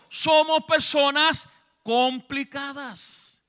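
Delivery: predicando a gritos con fervor; sermón a todo volumen, exhortando a los hermanos; predicación enérgica. Acted. Predica a pleno pulmón. (predicador fervoroso, voz fuerte)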